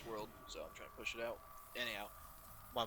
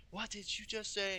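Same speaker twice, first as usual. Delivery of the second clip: higher, slow mock scream of an angry mom; 11 semitones higher, slower than usual. Mom bursting in on her gaming son, mock scream. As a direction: ham up the outraged mother. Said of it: "she just screamed".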